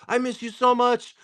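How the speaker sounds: high-pitched mock affection; gushing cousin. Cousin who never sees him gets sentimental, gushing. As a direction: imitate a tipsy cousin gushing.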